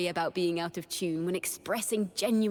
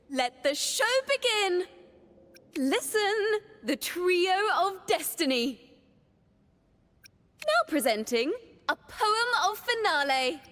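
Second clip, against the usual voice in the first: high, excited stage presenter; showman's announcement; 7 semitones higher than usual. Acted. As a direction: present the grand act to the crowd. Bard announcing a show to audience.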